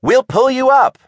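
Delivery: high, loud promise of help; calling down. A narrator's reading. Tilda calls down to the raft, loudly. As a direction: project it down over the railing. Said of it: "she called down to them".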